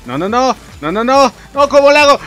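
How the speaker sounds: rising, loud, high no no no; panicked pleading. Real, not acted. Gamer panics as enemies close in, loud and high. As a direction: beg the game in panic. Panic in a hard game section.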